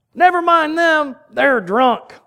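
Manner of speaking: loud, high hillbilly heckler. Acted. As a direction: holler it like a hillbilly from the crowd. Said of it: "I did that in my best hillbilly voice".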